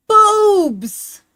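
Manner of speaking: crying out loud; loud shout. Real, not acted. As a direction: blurt it out as a loud cry. Blurted joke exclamation.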